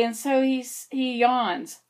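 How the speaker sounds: usual speaking voice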